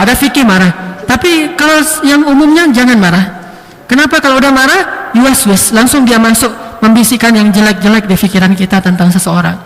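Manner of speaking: ordinary talk, nothing marked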